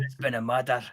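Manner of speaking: lightly put-on Scottish accent; a half-hearted Scottish detective line; a hint of Scottish accent. Shown as a demonstration. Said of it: "now I'm trying to do a Scottish one".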